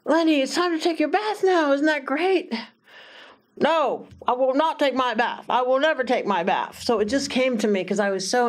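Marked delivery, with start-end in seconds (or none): 0.0-2.5 higher, bright sell of bath time; put-on enthusiasm. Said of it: "I will sweep him ... with my enthusiasm". 3.6-6.8 put-on defiant boy. Defiant boy voiced in story.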